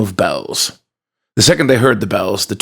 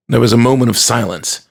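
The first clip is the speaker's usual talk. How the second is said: usual speaking voice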